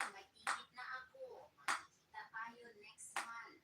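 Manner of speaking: loud, lightly mocked suitor's promise; imitated online suitor. Foreign chat partner promising a visit, lightly imitated suitor. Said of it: "Baka sabi niya lang yun".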